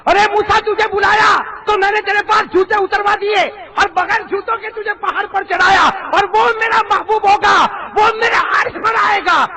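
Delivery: loud, high preaching voice; booming sermon. Acted. Preacher booming to the congregation at full force. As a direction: preach it out to the crowd.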